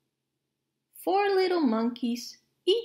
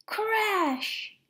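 exclaimed crash; 7 semitones higher than usual. A narrator's reading. Sound of monkey falling in story.

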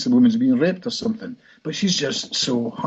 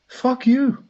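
much higher-pitched indignant refusal; defiant inner part; 11 semitones higher than usual. Retold or imitated. Client's clinging inner part refusing the hypnotist, indignant. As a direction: imitate a fed-up voice, pitched right up. Voices the part's angry refusal.

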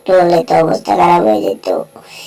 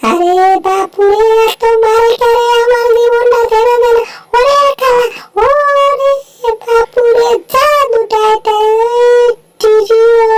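much higher, squeaky cry of pain; squeaky wailing cartoon; 14 semitones higher than usual. Beaten character wailing after the blows, squeaky high. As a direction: wail high like a small creature in pain.

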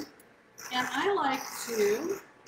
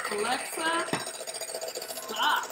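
raised voice; slower than usual. Real, not acted. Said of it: "She can't hear over the beaters".

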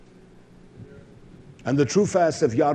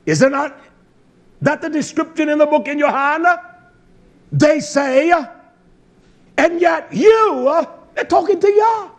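high, loud preacher's cry; full-force preaching; 13 semitones higher, 7 dB louder than usual. Real, not acted. Preacher shows listeners how people address Yah, full-force preaching. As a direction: hammer each phrase out at a high pitch.

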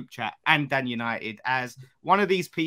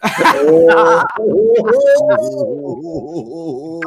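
loud laughter; 11 semitones higher, 11 dB louder than usual. Real, not acted. Bursts out laughing at the comment.

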